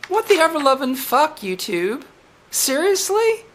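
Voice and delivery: high, incredulous rise; outraged disbelief. Real, not acted. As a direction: fling it at YouTube in disbelief. Outraged at bot.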